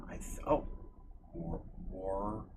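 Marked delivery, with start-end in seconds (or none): 1.3-2.4 trying out a Canadian pronunciation; tentative Canadian-accented "Warer". Shown as a demonstration. Streamer testing how Canadians say a word, Canadian accent. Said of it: "that's how they say it in Canada".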